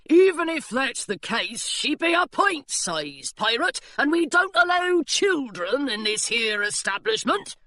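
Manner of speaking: put-on guard turning away the children; burly pirate. A narrator's reading. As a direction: lay down the rule, pirate style. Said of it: "said the pirate".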